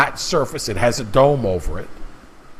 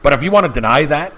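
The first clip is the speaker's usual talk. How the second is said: ordinary talk, nothing marked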